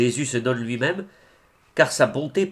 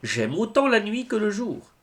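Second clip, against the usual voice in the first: high, lifted, sustained cry of love; exalted canticle; 9 semitones higher than usual. Acted. Quoting the saint's divine canticle of love, exalted and high. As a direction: let the line soar like a hymn. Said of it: "Tel était le divin cantique".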